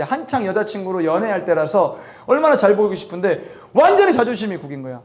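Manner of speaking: normal talking voice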